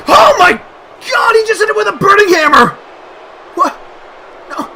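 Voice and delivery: very high, very loud cry; real commentator shriek; horrified screaming. Real, not acted. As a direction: scream in disbelief at a dangerous move. Shock at dangerous move.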